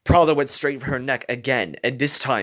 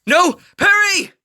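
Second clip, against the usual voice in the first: high, loud frantic "No! Perry!"; desperate cry; 9 dB louder, slower than usual. A narrator's reading. Scarlet crying out to Perry, high frantic shout. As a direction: yell out in alarm. Falcon attacked, Scarlet cries out.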